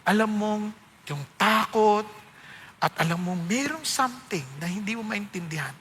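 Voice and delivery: soft, low preaching over music; altar call. Acted. Preacher calls the congregation to accept rescue, soft and low. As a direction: gently invite them to surrender.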